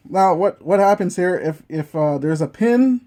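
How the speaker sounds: ordinary talk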